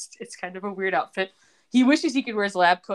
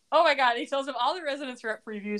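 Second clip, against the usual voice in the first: ordinary delivery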